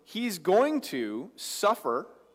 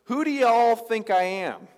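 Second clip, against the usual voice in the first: Jesus asking his disciples, slow and raised; a folksy, emphatic "Who do y'all think I am?"; lightly voiced Jesus, louder and higher; y'all question; 7 semitones higher, 9 dB louder than usual. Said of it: "but it says".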